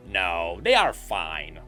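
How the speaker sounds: ordinary delivery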